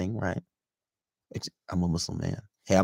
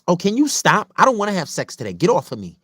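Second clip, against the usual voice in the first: high, loud, fed-up protest; imitating an irritated woman, pitched way up; mock annoyed girlfriend; 11 semitones higher, 8 dB louder than usual. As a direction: shove him away with your voice. Acted girlfriend rejecting her boyfriend.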